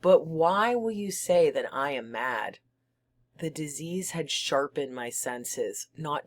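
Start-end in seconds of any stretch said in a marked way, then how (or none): none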